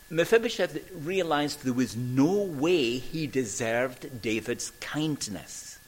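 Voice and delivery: ordinary delivery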